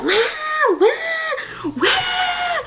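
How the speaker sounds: put-on very high, wailing baby cries; high mock baby wail; baby crying imitation. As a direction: mimic a wailing newborn. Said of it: "The baby's over here like".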